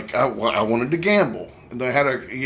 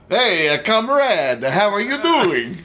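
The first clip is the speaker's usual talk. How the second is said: put-on Russian accent; 6 semitones higher, 3 dB louder, slower than usual. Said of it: "did a Russian accent".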